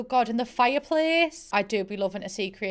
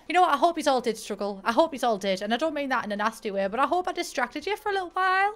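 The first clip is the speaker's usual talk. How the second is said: ordinary talk, nothing marked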